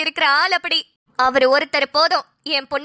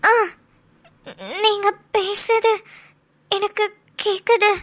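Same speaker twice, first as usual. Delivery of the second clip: weak dazed voice; 3 semitones higher, slower than usual. Acted. Patient waking, memory lost.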